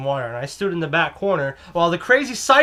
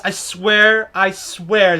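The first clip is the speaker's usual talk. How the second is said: usual speaking voice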